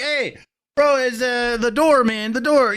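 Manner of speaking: a hint of a Mexican accent; lightly put-on Mexican accent.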